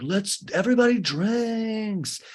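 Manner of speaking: high, drawn-out party call; rallying call. Retold or imitated. Tom rallies everybody to drink, mock party call.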